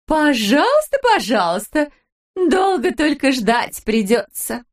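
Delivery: lightly voiced cooing old wife; sweet wife voice. A narrator's reading. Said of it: "проговорила Далила Петровна". (нараспев, выше голосом)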